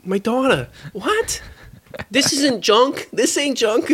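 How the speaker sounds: high, indignant character voice; movie dad voice. Retold or imitated. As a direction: squeak it out like an outraged dad.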